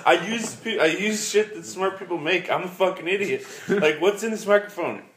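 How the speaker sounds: high-pitched mock exasperation; comic cluelessness. Podcaster admitting ignorance of the microphone, comically clueless. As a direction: squeal your own ignorance for laughs. Plays at being clueless about technology.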